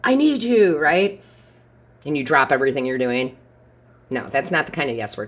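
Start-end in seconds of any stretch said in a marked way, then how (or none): 0.0-0.8 higher, louder whiny plea; mock needy friend. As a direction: imitate a clingy friend asking for help. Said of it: "quote unquote friend says".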